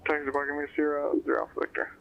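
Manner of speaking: ordinary talk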